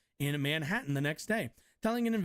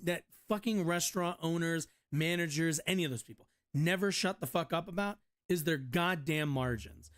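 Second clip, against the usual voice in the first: ordinary talk, nothing marked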